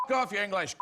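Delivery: put-on a heckler's shout in a thick Glasgow accent. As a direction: bark it like a rough Glasgow street heckler. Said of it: "in a good, uh, Glasgow accent".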